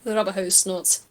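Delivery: ordinary talk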